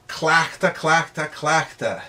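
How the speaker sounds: "Clachta" said crisply with an open ah, repeated; drill clachta. Shown as a demonstration. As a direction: drill "clachta" three times for the class.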